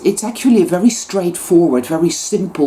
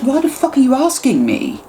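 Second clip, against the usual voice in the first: much higher, incredulous outburst; imagined snap back at people asking questions, exasperated; exasperated retort; 10 semitones higher than usual. Retold or imitated. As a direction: fire it back in disbelief. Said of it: "And it's like".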